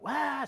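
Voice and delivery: high mock scream at ordinary volume. Conductor calling to her colleague, mock screaming. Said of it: "screamed to the other guy".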